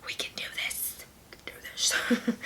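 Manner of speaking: whispered encouragement to herself; hushed pep talk. Real, not acted. Thirty-six weeks pregnant, whispering encouragement to herself. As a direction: quietly psych yourself up.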